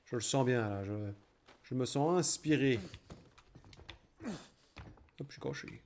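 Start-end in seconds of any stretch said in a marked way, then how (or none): 4.2-5.8 whisper. Pretend. Hiding, whispered.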